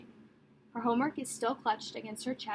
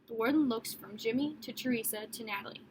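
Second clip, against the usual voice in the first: ordinary delivery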